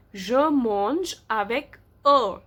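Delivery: very slow, word by word; slow model reading. Shown as a demonstration. Teacher reading written example to the class, slowly. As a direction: draw out each word for learners.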